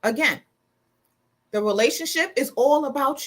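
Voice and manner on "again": usual speaking voice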